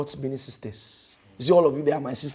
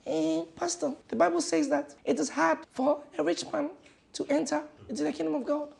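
a much higher, quick objecting voice; mock doubter; 13 semitones higher, 3 dB quieter than usual. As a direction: play a fussy churchgoer objecting to the pastor. Voicing a doubting churchgoer.

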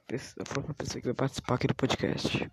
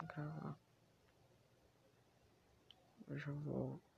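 quick unvoiced whisper; 13 dB quieter than usual. Real, not acted. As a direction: whisper it so nobody hears. Whispering, neighbor can hear.

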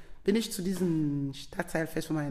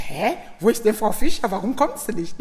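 louder, puzzled questioning; retold bafflement; 4 semitones higher, 10 dB louder than usual. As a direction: re-enact a baffled demand to know where someone is. Retold puzzled questioning about absent woman. (verwundert nachfragend, lauter)

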